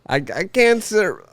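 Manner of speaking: mock-lament in a politician's voice; Trump. Retrying the cancer scenario, politician impression. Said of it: "I've been doing my Trump impression".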